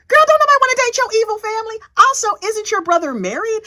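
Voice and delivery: high, fast sassy retort; sassy clapback. Real, not acted. Vlogger claps back at Lori with sass. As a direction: fire it off with attitude.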